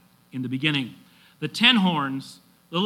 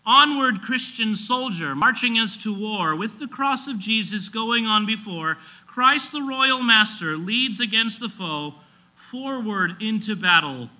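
high, rousing hymn recitation; ringing pulpit rallying cry; preaching at full force; 6 semitones higher, 3 dB louder than usual. Acted. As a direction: declaim it like a call to march.

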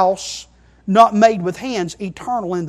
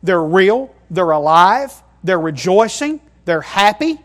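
ordinary delivery

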